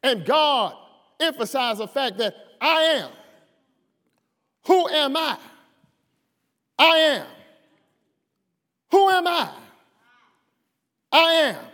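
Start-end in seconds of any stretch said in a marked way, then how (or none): 2.6-11.6 loud, higher call and answer; rising preaching. Acted. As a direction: hammer the question and the answer.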